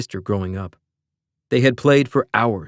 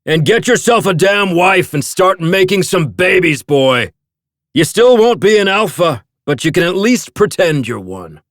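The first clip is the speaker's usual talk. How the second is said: loud, higher taunting call; calling after; 8 semitones higher, 6 dB louder than usual. A narrator's reading. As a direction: yell it after him mockingly. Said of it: "his father called after him".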